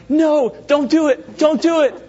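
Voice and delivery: hammed-up moviegoer panic; mock pleading yell. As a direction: beg the character to stop. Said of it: "you're like".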